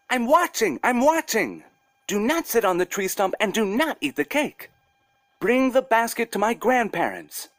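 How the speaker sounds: put-on high sing-song girl voice, stern warning; a hidden girl scolding the bear; little girl Masha. Masha from the basket warning the bear, high stern girl voice. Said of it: "Masha said again from the basket".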